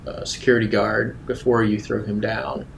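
usual speaking voice

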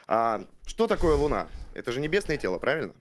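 ordinary talk

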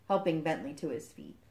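plain reading voice

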